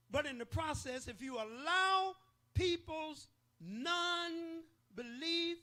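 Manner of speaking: high, loud, stretched whooping preacher; preacher pleading at full cry, nobody rising to a peak; preaching at full force. Real, not acted. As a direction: hit the climax and hold it. Climax of the sermon.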